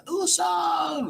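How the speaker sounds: very high, mock-screamed name; Nami screams. Retelling, Nami screams as both fall, very high. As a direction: yell his name as he falls off the cliff. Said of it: "And Nami screams".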